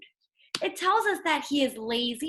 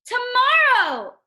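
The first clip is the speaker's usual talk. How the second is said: much higher, louder, slow drawn-out cry; cried out; 10 semitones higher, 8 dB louder, much slower than usual. A narrator's reading. Toad crying out to Frog, long and loud. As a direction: wail the word Tomorrow long and loud. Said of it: "cried Toad".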